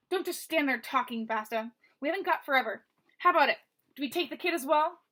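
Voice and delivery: loud, impatient thug with a pinched nasal tone; pinched-nose voice. Acted. Third thug hurrying Basta along, loud and pinched. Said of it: "as if the speaker's nose were being pinched".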